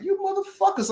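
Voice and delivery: very high-pitched, acted-out outrage; indignant protest. Acted protest at being robbed.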